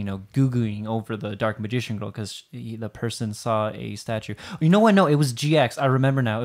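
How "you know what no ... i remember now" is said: excited. Real, not acted. Sudden remembering.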